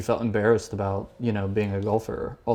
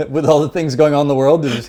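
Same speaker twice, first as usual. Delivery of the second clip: loud, higher words through laughter; laughing incredulity; 6 semitones higher, 10 dB louder than usual. Real, not acted. Co-host amused by politicians' golf chat, laughing incredulity. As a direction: crack up while marvelling at it. Cracking up at own story.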